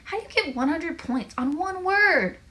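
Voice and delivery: high, loud disbelief; incredulous protest. A narrator's reading. Logan questions Joel's huge score, incredulous. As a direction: swoop up on the final word.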